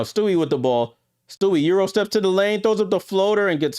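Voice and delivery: excited play-by-play call. Real, not acted. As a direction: call the Euro step with a jump in energy. Live play-by-play burst.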